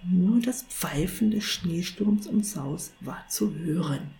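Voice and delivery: ordinary delivery, nothing marked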